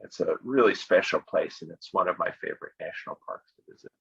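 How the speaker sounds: usual speaking voice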